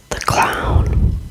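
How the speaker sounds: ordinary delivery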